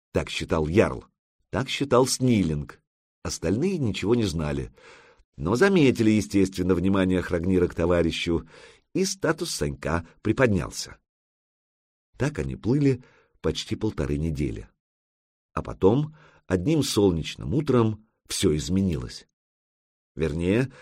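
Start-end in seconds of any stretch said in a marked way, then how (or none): none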